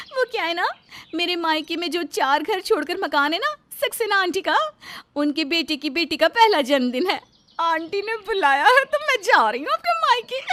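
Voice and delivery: lightly voiced excited wife; a touch of gleeful anticipation; happy young wife Rita. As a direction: pitch lifting as she shares happy news. Narrator acts Rita, pleased about visit.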